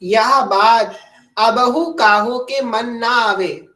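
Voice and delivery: loud, slow, drawn-out quotation; emphatic, stretched-out recital; scripture recitation. Retold or imitated. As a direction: proclaim the sacred verse word by word. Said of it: "आगे क्या कह रही हैं".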